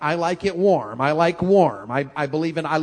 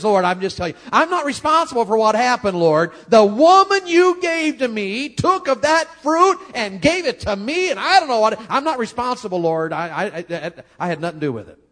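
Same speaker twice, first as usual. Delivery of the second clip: defensive pleading; 7 semitones higher, 7 dB louder than usual. Pretend. Adam blaming Eve before God.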